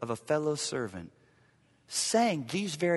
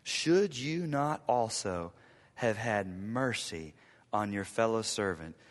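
ordinary talk

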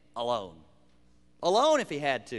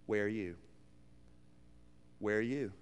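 much softer, lower, searching; soft question; 10 semitones lower, 13 dB quieter than usual. Real, not acted. Pastor quietly asking each listener, soft. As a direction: ask it gently, almost to yourself.

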